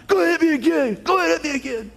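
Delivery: a squeaky dare to be hit; high mock taunt. Preacher mimicking Rocky's taunt in a high squeaky voice. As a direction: pitch it way up, teasing.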